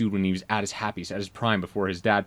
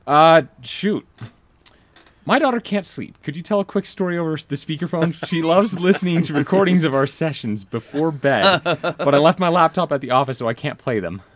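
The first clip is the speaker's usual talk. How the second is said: higher, louder apologetic friend; player voice; 7 semitones higher, 5 dB louder than usual. Retold or imitated. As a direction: sheepishly ask a late favor. Player voiced asking favor.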